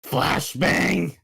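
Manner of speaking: high, loud warning shout; alarmed callout. Acted. Warning teammates of an incoming flashbang, loud shout. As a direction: yell it out to the squad.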